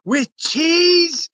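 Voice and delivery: high, eager put-on voice of a toothless man; toothless homeless guy. Homeless man on the curb asking the narrator, high and eager. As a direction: squeak it out like a hungry man with missing teeth. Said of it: "and he's missing all these teeth".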